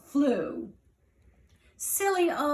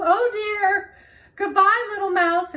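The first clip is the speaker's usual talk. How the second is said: scared cry; 6 semitones higher, 5 dB louder than usual. Acted. Snake flees crying.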